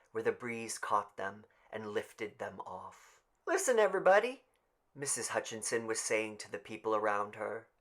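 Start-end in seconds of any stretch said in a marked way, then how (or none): 3.5-4.4 high, loud call for attention; appealing to the crowd. Acted. Tessie to the people around her, loud and high. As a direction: call the neighbours to listen.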